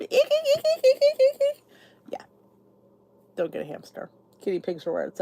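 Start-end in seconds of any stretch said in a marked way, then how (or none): 0.0-1.6 very high squeaking eek eek; squeaky wheel imitation. Complaining about the hamster's wheel at night, high squeaks.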